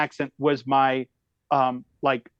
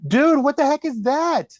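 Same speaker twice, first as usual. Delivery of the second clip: baffled outburst; 11 semitones higher, 4 dB louder than usual. Retold or imitated. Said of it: "I'm like".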